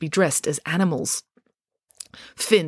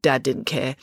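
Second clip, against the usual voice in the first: ordinary delivery, nothing marked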